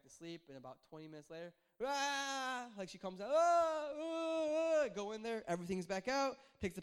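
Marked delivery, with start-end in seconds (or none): 1.9-2.7 imitated baby cry. Imitating baby crying again. 3.3-4.9 put-on higher, much louder baby wail; loud imitated baby cry. Imitating baby crying again.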